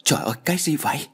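high, quick gasp of alarm; panicked. A narrator's reading. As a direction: blurt it out in a breathy, startled half-whisper. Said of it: "Tôi hốt hoảng nói".

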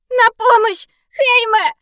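high, loud begging cry for help; pleading scream. Acted. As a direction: beg for help at full voice. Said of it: "взмолилась я ... что было сил".

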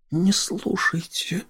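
whispered seductive purr; breathy vintage purr. Acted. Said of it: "промурлыкала Доротея". (мурлыкающий шёпот)

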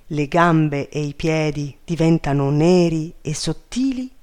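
ordinary delivery

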